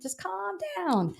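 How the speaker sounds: high, drawn-out mock plea; calming someone down. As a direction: stretch it out to rein in an eager client. Telling an over-eager client to wait.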